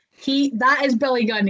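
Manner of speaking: usual speaking voice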